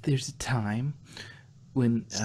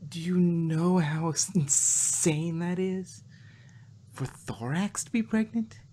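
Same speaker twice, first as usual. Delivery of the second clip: exasperated disbelief; 5 semitones higher than usual. A narrator's reading. Twilight stressing absurdity to Spike.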